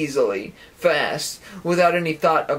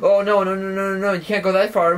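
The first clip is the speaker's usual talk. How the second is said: panicked protest; 5 semitones higher than usual. Acted. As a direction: act out a rush of alarmed refusal. Acting out overwhelmed protest.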